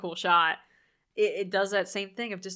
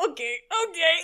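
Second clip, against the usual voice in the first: laughing scream; 8 semitones higher, 3 dB louder, faster than usual. Retold or imitated. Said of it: "I was screaming 'cause I was like".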